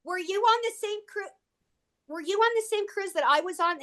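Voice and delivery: asks high through laughter; laughing question. Real, not acted. Laughing, excited question to fan.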